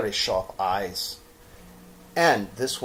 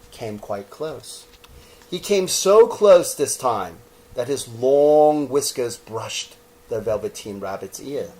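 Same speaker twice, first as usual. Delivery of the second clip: ordinary delivery, nothing marked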